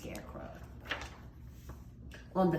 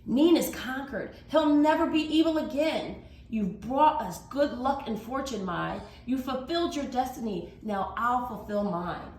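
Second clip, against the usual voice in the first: triumphant warrior; 6 semitones higher, 4 dB louder than usual. Acted. Warrior proclaims victory.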